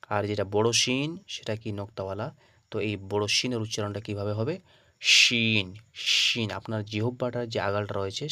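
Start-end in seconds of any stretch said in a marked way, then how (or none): 5.0-6.5 শিন with a full, heavy "sh"; tongue tip turned inward for a strong শিন; thick "sh". Shown as a demonstration. Teacher shows big sheen, শিন thick and firm. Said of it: "আওয়াজটা একটু মোটা হবে".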